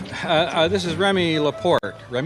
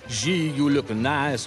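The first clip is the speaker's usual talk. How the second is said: put-on slow, drawn-out French-accented compliment; mock French accent; 4 semitones higher, 3 dB louder, slower than usual. Friend imitating the Frenchman's compliment, mock French accent. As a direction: lay on a teasing French accent, slowly. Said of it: "with a French accent".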